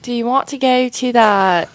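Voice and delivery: bad British accent, abandoned midway; attempted British accent. Shown as a demonstration. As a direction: have a halting go at a British accent. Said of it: "do a British accent".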